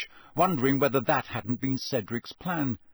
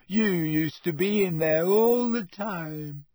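slow, higher, miserable moan; 5 semitones higher, 4 dB louder, slower than usual. Acted. Neglected ghost reproaches Harry, miserable. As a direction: draw it out, wounded and self-pitying. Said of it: "said Myrtle miserably".

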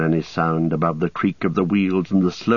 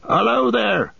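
much higher hail into the fog; cry out; 13 semitones higher than usual. A narrator's reading. Farmer hails the unseen rider, calling out. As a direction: call out sharply to the rider. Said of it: "cried Farmer Maggott".

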